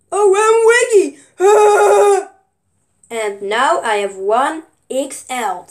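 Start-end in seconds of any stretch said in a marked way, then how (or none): 0.1-1.2 high, loud and quick; excited. Real, not acted. Child's excited show-and-tell. 1.4-2.2 high, bursting laugh; loud giggle. Real, not acted. Laugh after showing toy.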